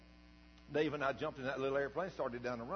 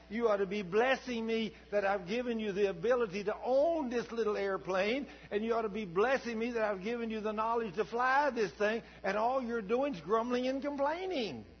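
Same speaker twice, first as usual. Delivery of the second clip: God rebuking; 8 semitones higher, 5 dB louder than usual. Retold or imitated. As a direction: preach it as God scolding a grumbler. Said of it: "hearing God sitting there saying".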